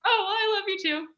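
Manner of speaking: high and quick, gushing love you too; excited reply. Retold or imitated. To the boyfriend who just said it, high and fast. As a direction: rush it out, thrilled.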